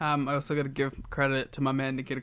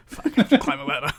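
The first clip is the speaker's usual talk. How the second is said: fast, higher, laughing mock dread; mock serious Dusty; 9 semitones higher, 6 dB louder, much faster than usual. Host jokes Dusty dreading the ladder, fast and laughing. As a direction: blurt it out like grim resignation. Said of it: "he's like".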